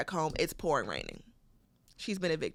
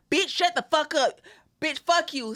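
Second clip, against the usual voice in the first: cussing customers; 9 semitones higher, 3 dB louder, slower than usual. Retold or imitated. Angry customers cussing her out.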